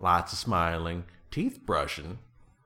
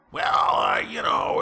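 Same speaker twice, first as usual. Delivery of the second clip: harsh, loud, hoarse bark; throat-shredded shout from yelling into pillows; yelled-out rasp; 6 semitones lower, 7 dB louder, slower than usual. Acted. Explaining the pillow-yelling, in a loud hoarse rasp. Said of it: "that's how I got my voice to do this".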